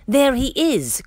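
high, excited storybook shout; excited high-voiced spotting; excited cry. A narrator's reading. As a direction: call out the sighting, pitched high. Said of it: "cried Dinoceros".

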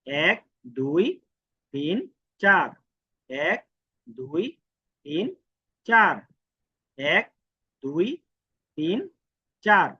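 counting the strokes very slowly; slow demo count for students. Shown as a demonstration. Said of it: "এই এক টান, দুই টান".

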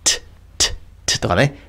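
isolated "トゥ" as a short, voiceless English T; consonant demo. Shown as a demonstration. Teacher showing English consonants, isolated voiceless T on "トゥ". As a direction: let "トゥ" pop as a bare consonant. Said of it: "英語ですごく大事な子音です".

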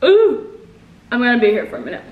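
chewing sour candy; puckered sour face speech; talking with mouth full. Real, not acted. Seen 2: Candy put in mouth at -9; puckered, squinting sour face while talking.

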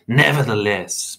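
slow, firm objection; insist, drawing the word out; insistent. A narrator's reading. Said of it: "Mister Crepsley insisted".

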